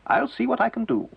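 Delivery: a hint of a helpful lady; lady magician. A narrator's reading. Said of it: "said Missus Smithers Rowbottom".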